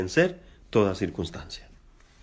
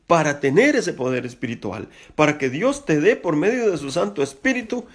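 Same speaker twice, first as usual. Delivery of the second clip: higher, rising exhortation to the church; fervent preaching; 7 semitones higher than usual. Acted. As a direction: urge them on with building fervour. Exhorting the congregation. (predicando con fervor creciente)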